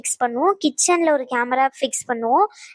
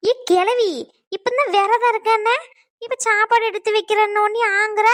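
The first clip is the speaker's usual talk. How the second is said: mocking, pitched higher; taunting jab; 7 semitones higher than usual. [name] taunting the grandmother about her fasting story, pitched up. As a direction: needle the old woman for her lies.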